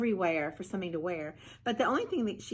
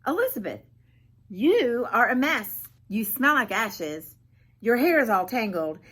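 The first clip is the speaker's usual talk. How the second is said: snooty prince; 4 semitones higher, 9 dB louder than usual. Acted. Prince scolding Elizabeth for her messy look, snooty. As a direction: look down your nose at her. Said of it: "he looked at her and he said".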